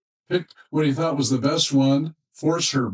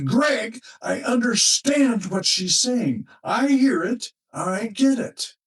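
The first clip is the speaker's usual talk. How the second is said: husband insisting, higher, each claim pressed; emphatic; 10 semitones higher, slower than usual. Retold or imitated. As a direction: lean hard on "understand", a bit slow. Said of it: "He turned to me and said emphatically".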